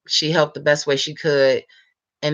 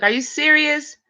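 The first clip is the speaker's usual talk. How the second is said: very high, squealing disbelief; surprised disbelief; 12 semitones higher than usual. Retold or imitated. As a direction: squeal it out in happy shock. Retold reaction to a surprise gift.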